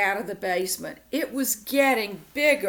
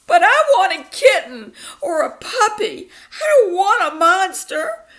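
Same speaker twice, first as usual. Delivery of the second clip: higher, louder crying plea; bawling; 11 semitones higher, 10 dB louder than usual. Acted. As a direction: sob the wish out. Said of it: "I bawled".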